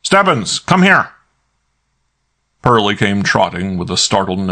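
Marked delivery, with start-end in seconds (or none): 0.0-1.1 high, loud bellow. A narrator's reading. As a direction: bellow it down the hall. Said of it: "pulled it open and bellowed".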